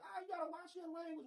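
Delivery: high, loud prissy scold; mock scolding colleague.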